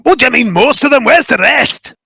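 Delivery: high, sharp Scottish questioning; worried Scottish mycon. Acted. Fergus demanding where the rest of his kin are, high Scottish voice. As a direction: press for the truth.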